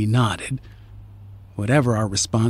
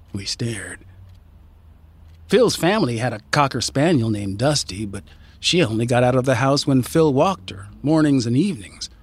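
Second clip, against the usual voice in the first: usual speaking voice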